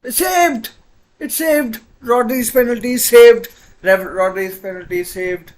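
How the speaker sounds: screaming high and loud; ecstatic shout. Real, not acted. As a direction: erupt with joy at the save. Keeper saves, commentator erupts.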